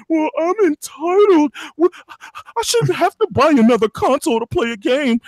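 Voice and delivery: high-pitched mocking whine; whiny entitled gamer. Host mocking gamers who refuse to buy consoles, whiny. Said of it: "that's my asshole voice".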